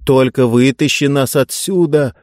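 plain reading voice

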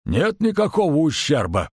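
high, level-headed tavern keeper; sober innkeeper. A narrator's reading. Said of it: "трезво проговорил трактирщик".

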